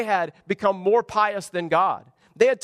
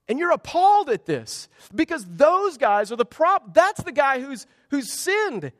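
appalled outrage; 5 semitones higher, 3 dB louder than usual. Retold or imitated. Preacher voicing outraged disgust.

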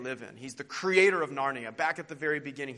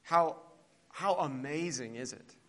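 prayer. Acted. Preacher praying aloud for the congregation, reverent. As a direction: pray earnestly before the people.

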